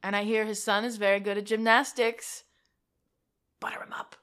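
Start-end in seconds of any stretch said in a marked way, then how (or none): 3.6-4.2 soft unvoiced whisper. Real, not acted. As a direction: say it under your breath. Whispered aside.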